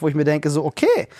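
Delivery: ordinary delivery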